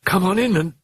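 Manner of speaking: high, quavering distress. A narrator's reading. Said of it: "Chris quavered".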